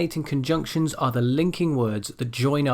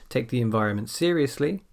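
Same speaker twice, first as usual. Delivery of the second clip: "seriously" lifted at the end; rising intonation. Shown as a demonstration. Teacher shows the end of a dependent clause, "seriously" rising. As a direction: leave "seriously" hanging upward. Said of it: "my voice goes up a bit".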